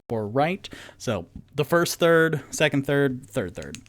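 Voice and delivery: ordinary talk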